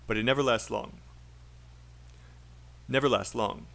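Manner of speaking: "never lasts long" linked as one chunk; "never lasts long" run together without a break. Shown as a demonstration. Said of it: "Chunking is saying a set together".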